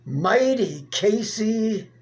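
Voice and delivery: plain reading voice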